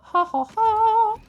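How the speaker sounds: shrill mock cackle, slow; high fake laugh. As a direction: cackle it slowly like a cartoon.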